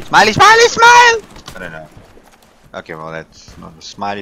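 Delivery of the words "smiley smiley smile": excited high shout. Real, not acted. Typing smiley in chat.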